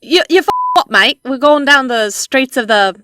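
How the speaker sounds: stroll the streets as a cheeky Cockney; mock Cockney. Riffing on Assassin's Creed Syndicate, jaunty Cockney accent. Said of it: "Assassin's Creed".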